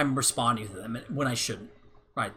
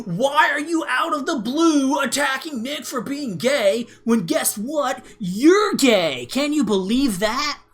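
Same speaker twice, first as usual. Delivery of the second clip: higher, louder, slow indignant mock voice; mocking heckler; 9 semitones higher, 5 dB louder, slower than usual. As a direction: ham up a shocked, self-righteous critic. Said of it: "someone else comes in and goes".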